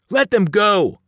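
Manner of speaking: high, loud demand; defiant yell. A narrator's reading. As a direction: yell it at the giants. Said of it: "I yelled at the giants".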